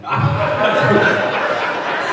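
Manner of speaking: loud unvoiced burst of laughter; real loud laugh; hearty laugh. Real, not acted. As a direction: crack up at your own joke. Laughing after joke about mom.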